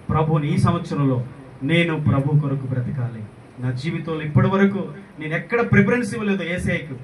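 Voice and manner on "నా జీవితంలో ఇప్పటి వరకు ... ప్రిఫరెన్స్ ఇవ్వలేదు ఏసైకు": rising to a high, loud confession; impassioned preaching. Real, not acted. Preacher voicing a believer's confession to Jesus, impassioned preaching. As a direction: build into a ringing, insistent declaration.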